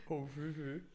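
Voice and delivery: posh English. Shown as a demonstration. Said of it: "a proper English?".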